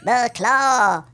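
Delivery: lightly done squeaky alien; a hint of the little claw-machine aliens; Toy Story alien voice. Recalling her Toy Story line, light alien squeak. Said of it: "I am the voice of the aliens".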